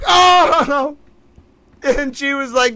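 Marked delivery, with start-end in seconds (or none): 0.1-1.0 very high, loud cry of shock; shocked shriek "Oh!". Real, not acted. Streamer realizing the scheme, high shocked shout. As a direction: yelp in sudden disbelief.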